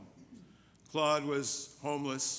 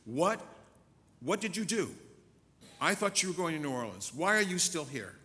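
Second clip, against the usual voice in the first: upset confrontation; 5 semitones higher, 5 dB louder than usual. Retold or imitated. Janet confronts Claude after betrayal.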